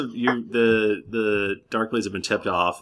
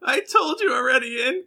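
very high, half-shouted weepy protest; crying protest. Acted. Scared girlfriend protests tearfully to the adventurers. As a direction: insist through tears.

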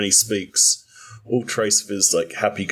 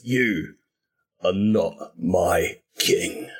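"You are not my king" with every word hit, slow; each word stressed; much slower than usual. Acted. Undead Mort to the fallen king, each word stressed. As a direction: jab out each word, slowly. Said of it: "emphasizing each word".

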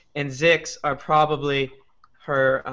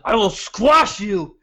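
goblin threat; 5 semitones higher, 4 dB louder, slower than usual. Acted. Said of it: "I say in Goblin".

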